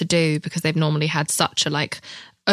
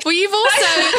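laughing; 11 semitones higher, 4 dB louder than usual. Real, not acted. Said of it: "laughing at screaming story".

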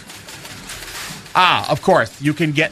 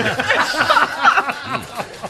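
high burst of laughter; big laugh; 9 semitones higher than usual. Real, not acted. Big laugh after punchline.

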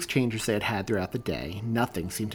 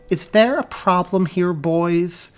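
stern teacher; 8 semitones higher, 10 dB louder, slower than usual. Acted. Teacher confronting bullies.

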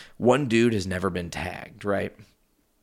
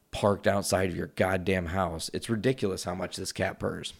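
usual speaking voice

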